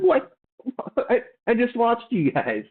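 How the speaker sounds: retold shock at seeing them on screen; stunned disbelief. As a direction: jolt up in surprise like you can't believe it. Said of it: "I was like".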